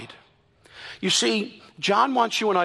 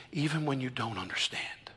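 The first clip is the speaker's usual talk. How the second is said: breathy whisper, much softer and lower; 7 semitones lower, 13 dB quieter than usual. Acted. Jesus reassuring his followers, whispered. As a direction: lean in and whisper it.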